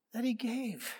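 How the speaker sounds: a hushed, reflective repeat; soft echo. Shown as a demonstration. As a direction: let the words sink in gently.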